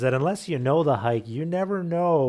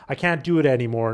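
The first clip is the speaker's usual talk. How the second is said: louder, fast frustrated cry; exhausted child crying out, louder and fast; tired cry; 2 semitones higher, 7 dB louder, faster than usual. Retold or imitated. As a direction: blurt it out worn down. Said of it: "another one cries out".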